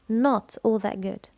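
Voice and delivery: "not" punched for a full negation; stress on NOT. Shown as a demonstration. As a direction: lean on "not", the rest lighter. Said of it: "عملنا الاسترس على النفي". (التشديد على "not" للنفي)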